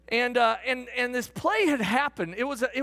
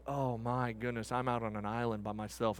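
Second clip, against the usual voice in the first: low, soft, sinking realisation; dawning dismay; 11 semitones lower, 8 dB quieter than usual. Retold or imitated. Fan realising he sits all alone, low and quiet. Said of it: "that feeling of like".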